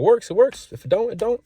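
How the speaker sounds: high, loud devil-may-care; carefree shrug. Real, not acted. Shrugging off a possible rejection, high and carefree. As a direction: toss it off, who cares.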